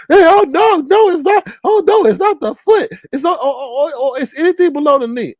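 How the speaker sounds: high mocking voice of the arguing players; mocking opponents. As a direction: squeal their excuse back at them, laughing. Mocking the other team's claim.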